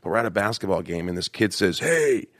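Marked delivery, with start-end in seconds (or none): 1.8-2.3 higher-pitched jeering shout-out; mock bully calling someone out; taunting bully. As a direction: heckle like a schoolyard bully. Voices a bullying kid.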